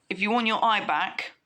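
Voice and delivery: plain reading voice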